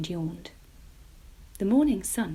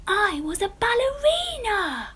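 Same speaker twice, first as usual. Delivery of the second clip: high, loud gleeful cry; 14 semitones higher, 8 dB louder than usual. A narrator's reading. Jemima telling mamma her dream, high and gleeful. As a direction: announce it with delight. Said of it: "said gleefully".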